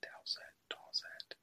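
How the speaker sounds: very soft, slow whisper; whispered try-out. Real, not acted. Host testing a surname under his breath, slow whisper. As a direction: whisper it to yourself, testing each version. Trying out the name to himself.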